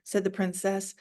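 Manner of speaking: ordinary delivery, nothing marked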